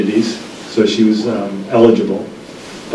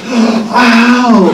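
high, loud hammed-up yelp of pain; mock ow; 12 semitones higher, 3 dB louder than usual. Son mimicking his mom's late yelp after the shot. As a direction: play her delayed ouch, big and high. Mom reacting after being told.

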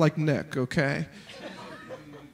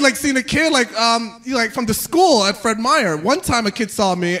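ordinary talk, nothing marked

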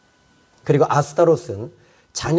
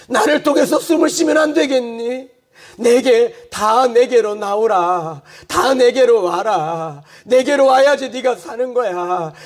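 the Lord's invitation, rising to higher, fuller cries; fervent plea; 8 semitones higher than usual. Acted. Jesus calling the burdened to come, fervent plea. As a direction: plead harder with every line.

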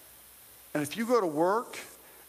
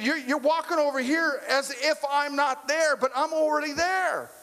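voice of God; 7 semitones higher than usual. Retold or imitated. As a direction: speak as God, lifted and unhurried. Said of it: "He said".

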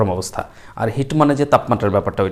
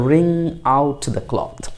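very slow, every word drawn out; slow model phrase; much slower than usual. Shown as a demonstration. Teacher showing students the English phrase, very slow. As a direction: stretch each word so learners catch it. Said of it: "এটাকে আমরা বলবো".

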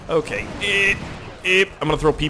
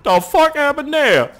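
mocking a bystander; 14 semitones higher, 7 dB louder than usual. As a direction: play the gawking guy, over the top. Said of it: "The guy is like".

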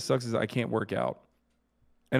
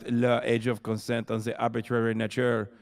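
put-on French accent. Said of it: "it's more of a Quebecois accent".

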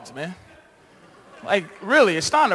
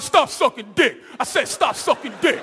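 mock drill sergeant; 3 semitones higher than usual. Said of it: "he's cursing out your daughter".

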